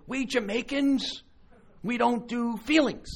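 a hint of a proud Jamaican woman. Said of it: "she said she's Jamaican".

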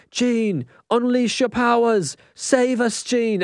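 hammed-up British accent, pitched high; Professor X. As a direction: plead urgently in a bad British accent. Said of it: "that's a god-awful accent".

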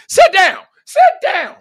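loud, high-pitched yell; yelled command. Acted. Coach yelling at a kid to sit down, high shout. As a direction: bark it out way up high, twice. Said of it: "since you yelling like this".

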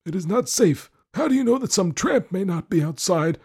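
put-on high, loud, fretful old husband; scolding old lord. Lord scolds wife about his medals, suspicious.

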